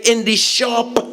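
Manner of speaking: put-on high-pitched old woman voice; high answer from an old lady; old lady's reply. Old lady saying where she's headed, high voice. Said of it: "She say".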